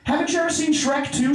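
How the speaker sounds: mock Oprah amazement. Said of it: "She's like".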